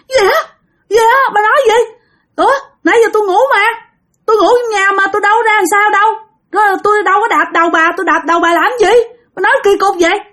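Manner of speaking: very high, baffled protest; acted alarm in a high-pitched voice; startled. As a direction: deny it like a husband jolted out of sleep. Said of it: "ông ngoại mới hết hồn".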